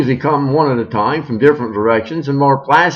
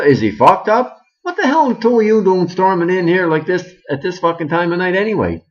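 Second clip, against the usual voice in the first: usual speaking voice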